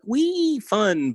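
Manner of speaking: high, drawn-out squeal; tiny falsetto cheer; mock glee. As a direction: squeal it way up high like a child on a slide. Mock glee about dull exercise.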